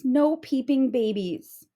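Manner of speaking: ordinary delivery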